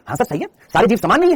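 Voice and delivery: ordinary talk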